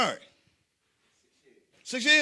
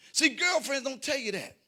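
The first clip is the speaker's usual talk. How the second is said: ordinary talk, nothing marked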